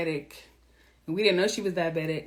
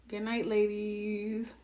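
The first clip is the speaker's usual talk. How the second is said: slow, softer farewell; soft goodnight; 7 dB quieter, much slower than usual. Real, not acted. Signing off to her ladies, soft and slow. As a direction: ease them off to sleep.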